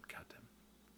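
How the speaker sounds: unvoiced frustrated whisper; whispered curse. Real, not acted. Vlogger whispering frustration at losing his train of thought. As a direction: breathe the curse out.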